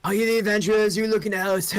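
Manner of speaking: a friendly king who took something; young king. Acted. King welcoming the adventurers, high and loose.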